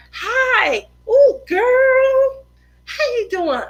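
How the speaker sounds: high, drawn-out mock gushing hello; generic friendly greeting. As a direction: greet someone whose name you forgot, overly chummy. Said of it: "you're just trying to speak real general".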